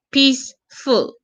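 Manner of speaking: peace, ful, each syllable set apart. Shown as a demonstration. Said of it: "That's two syllable".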